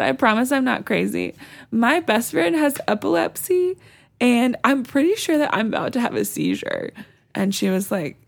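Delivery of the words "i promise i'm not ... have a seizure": high, pleading, half-laughed retelling; imitating her own teary plea; mock sobbing plea. To the night nurse, a high pleading mock sob. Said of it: "that kind of sobbing where you're trying to talk".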